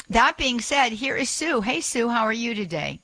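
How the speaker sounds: bright high-pitched cheerful welcome; happy, energetic. Real, not acted. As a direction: greet your guest full of energy, voice lifted. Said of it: "you sound so nice and happy and full of energy".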